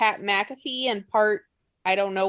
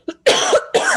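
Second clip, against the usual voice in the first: cough; 7 dB louder than usual. Real, not acted. Loud cough.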